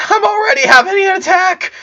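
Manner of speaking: very high, loud, breathless cry; gasping panic; panicked yell. A narrator's reading. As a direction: hysterical kid shouting. Clyde in panic, asthma.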